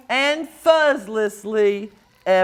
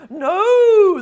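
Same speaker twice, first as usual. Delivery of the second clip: scared cry; 8 semitones higher, 6 dB louder than usual. Acted. Crowd as he falls.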